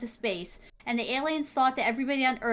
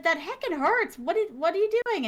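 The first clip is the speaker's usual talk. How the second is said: mock protest; 7 semitones higher than usual. Protesting to mom.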